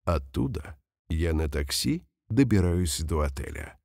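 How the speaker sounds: ordinary talk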